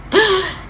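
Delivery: sharp gasp. Real, not acted. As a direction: gasp in mock outrage. Indignant at brother.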